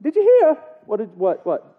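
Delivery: lightly put-on Irish accent, still searching for it; attempted Irish accent. Two buddies meet on the street, attempted Irish accent. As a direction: fumble into an Irish brogue. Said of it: "I'm gonna get that accent".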